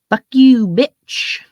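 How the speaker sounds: put-on sudden loud bratty kid yell; kid cussing out mom. Five-year-old yelling at his mama over cereal, loud bratty shout. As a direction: belt it like a furious little kid at the store. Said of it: "little five-year-old kid looking at his mama going".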